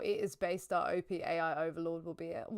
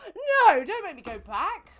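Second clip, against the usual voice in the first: very high, loud wail of no; pleading cry; 9 semitones higher, 11 dB louder than usual. Real, not acted. Streamer dreading being sent back, wailing high and loud. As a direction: beg the game, voice jumping up. Game sends him back.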